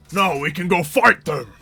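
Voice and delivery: loud, rousing orc voice. Acted. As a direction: bellow it like an orc itching to fight. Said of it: "The orc who's in there is like".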